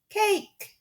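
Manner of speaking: put-on high baby shriek of cake. Sunny shrieking for cake. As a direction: squeal it like a toddler. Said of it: "Sonny shrieked".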